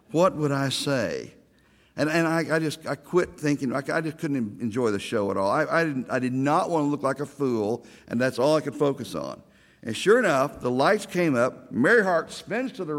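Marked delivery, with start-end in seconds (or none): none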